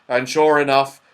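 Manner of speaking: usual speaking voice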